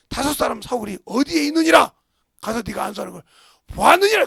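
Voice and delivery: loud, solemn proclamation; the Lord instructing Ananias loudly; booming divine voice. Retold or imitated. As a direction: preach it as God's command. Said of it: "그래서 뭐래".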